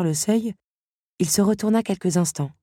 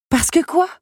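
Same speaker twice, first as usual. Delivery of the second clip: high, loud, rising question; pressing; 12 semitones higher, 5 dB louder than usual. A narrator's reading. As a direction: push eagerly for the rest of the answer. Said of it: "la relança Belle". (relance pressante, voix aiguë)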